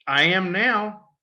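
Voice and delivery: deadpan it back at him; dry sarcastic reply. Retold or imitated. Freshman answering the coach after the brawl, wry.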